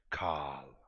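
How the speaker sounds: low, breath-heavy "Cahl"; Simpsons character impression. Streamer saying a viewer's name like a Simpsons character, low drawn-out impression. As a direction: draw the name out low, almost whispered. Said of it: "I like to say Carl's name like".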